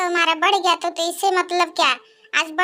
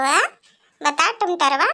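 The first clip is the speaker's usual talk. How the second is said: usual speaking voice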